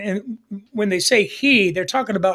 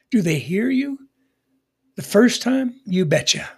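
ordinary talk

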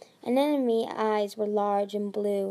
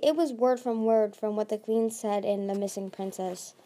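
ordinary delivery

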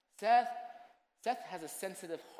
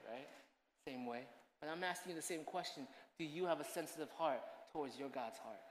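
ordinary talk

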